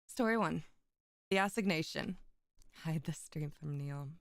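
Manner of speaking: plain reading voice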